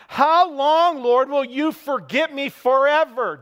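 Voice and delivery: loud, high anguished shout; shouting to God. Retold or imitated. David shouting at God in his frustration, loud and high. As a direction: cry it out to God at full voice. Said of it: "I believe he's shouting to God".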